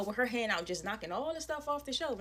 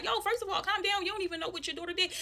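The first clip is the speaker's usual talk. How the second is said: retold heated protest; 9 semitones higher than usual. Her heated reply in retold quarrel.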